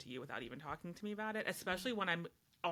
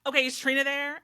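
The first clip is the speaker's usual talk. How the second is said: put-on high, loud annoyed teen; impatient caller; 9 semitones higher, 12 dB louder than usual. Friend on the phone, impatient and louder. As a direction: push past the greeting. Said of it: "they would be like".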